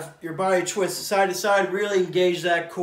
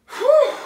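loud high "whew" of relief; exhausted exhale; 9 dB louder than usual. Real, not acted. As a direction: blow out a tired whew. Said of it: "I'm tired".

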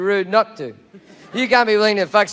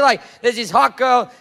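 ordinary delivery

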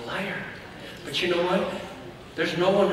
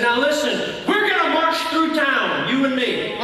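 high, slow, emphatic marching order; commanding; 9 semitones higher, 3 dB louder than usual. Acted. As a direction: lay down the plan like a drill leader. Evangelist orders the man to march.

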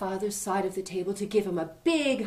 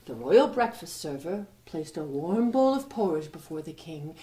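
ordinary delivery, nothing marked

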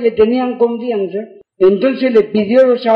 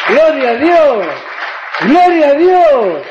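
gloria en alto y más fuerte; alabanza a gritos; 6 semitones higher than usual. Real, not acted. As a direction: proclamar con fervor creciente. Alabanza creciente. (exclamación fervorosa de alabanza)

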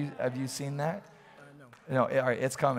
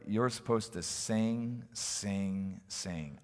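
trailing off softer and slower; fading to soft; 4 semitones lower, 6 dB quieter, much slower than usual. Real, not acted. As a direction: let each sing fade away. Urging him, voice fading.

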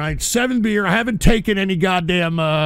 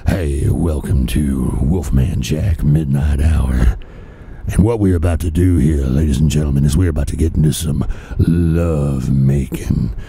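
Wolfman Jack. Pretend. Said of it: "I should be talking like Wolfman Jack".

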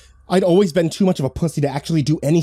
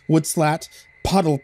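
usual speaking voice

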